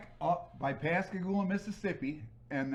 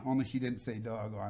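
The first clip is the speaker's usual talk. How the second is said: ordinary talk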